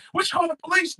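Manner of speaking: scandalized and disgusted; wife catching husband watching video; shocked wife. Acted.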